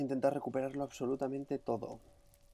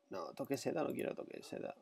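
muttering; 8 dB quieter than usual. Real, not acted. Muttering at inventory.

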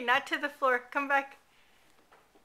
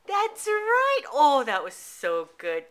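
excited praise; 3 semitones higher, 7 dB louder than usual. Real, not acted. Parent thrilled toddler found chin, excited gushing.

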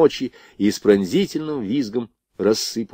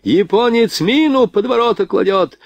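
high-pitched cry of warning; alarmed shout; 11 semitones higher, slower than usual. A narrator's reading. Rifleman warns comrades of a mine, shouting in alarm. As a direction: cry out to warn everyone, way up high. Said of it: "вдруг закричал подбежавший стрелок".

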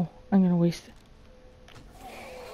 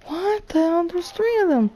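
high-pitched alarmed outcry; 8 semitones higher than usual. Real, not acted. Player finding three zombies after them, alarmed. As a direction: cry out in dismay, pitch jumping up.

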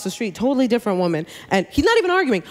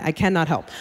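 normal talking voice

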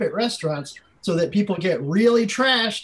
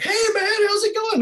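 much higher, chummy dude voice; friendly bro greeting. Retold or imitated. Frat guy meeting him on the sidewalk, chummy bro greeting. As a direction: greet him like an old party buddy.

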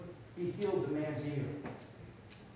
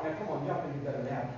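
ordinary delivery

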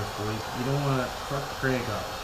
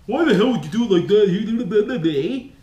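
slow, louder mocking critic's jab; smart-ass commenter; 4 semitones higher, 6 dB louder, slower than usual. Vlogger mocking a smart-ass viewer comment, slow and sneering. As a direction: sneer it like a know-it-all commenter. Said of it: "somebody's gonna be on there with a smart-ass comment".

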